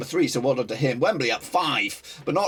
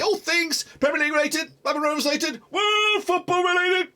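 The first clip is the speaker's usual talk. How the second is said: hyped intro; 13 semitones higher than usual. Real, not acted. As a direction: belt out the channel intro with rising hype. Hyped channel intro roll-call.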